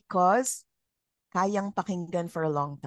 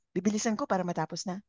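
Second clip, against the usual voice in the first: rushing; much faster than usual. Retold or imitated. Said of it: "tempted to speed up".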